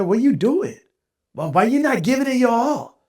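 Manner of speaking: put-on high scolding in a thick Spanish accent; reaming out. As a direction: imitate a Spanish-accented telling-off, loud at the end. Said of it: "went on to ream me the hell out".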